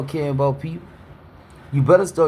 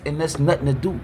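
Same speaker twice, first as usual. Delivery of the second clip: ordinary talk, nothing marked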